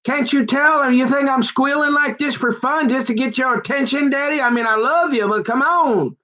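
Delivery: high pitched, squealing plea to dad; mock screaming plea. Son on the floor begging dad to take him. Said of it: "I'm screaming here".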